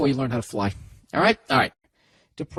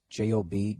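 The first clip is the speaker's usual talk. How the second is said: usual speaking voice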